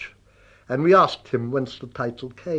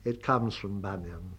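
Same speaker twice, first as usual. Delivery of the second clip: tossed off lightly, much softer; softer, offhand throwaway line; throwaway casual; 5 semitones lower, 10 dB quieter than usual. Retold or imitated. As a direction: drop it as if it hardly matters. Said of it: "quite casually in a throwaway voice".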